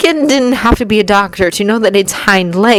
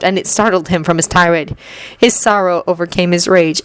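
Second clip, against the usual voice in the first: ordinary delivery, nothing marked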